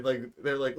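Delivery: usual speaking voice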